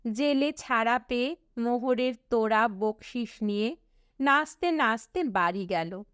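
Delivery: ordinary delivery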